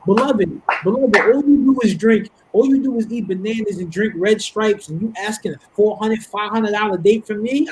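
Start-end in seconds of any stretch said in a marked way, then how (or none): none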